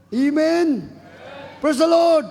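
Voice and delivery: ringing shout of Praise the Lord. Real, not acted. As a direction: call it out to the whole church. Preacher calls out to congregation.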